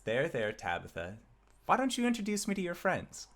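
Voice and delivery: high, fast sweet granny voice; soothing old halfling. Acted. Grandmother soothing Tabitha, sweet high voice. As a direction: comfort her then turn sweetly to the party. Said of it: "this halfling individual says".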